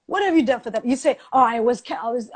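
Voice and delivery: ordinary talk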